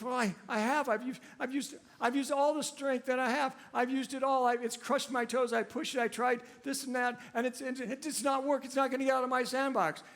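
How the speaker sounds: put-on high, whiny little boy protesting; boy insisting he tried everything; exasperated little boy. To his father, an exasperated little boy whining high. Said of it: "Little boy is exasperated by that comment".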